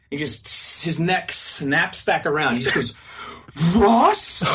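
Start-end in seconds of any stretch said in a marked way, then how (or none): 3.8-4.4 loud, high, rising question; mock shocked recognition. Man whipping round on the escalator, acted astonishment. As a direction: blurt it as a stunned double take.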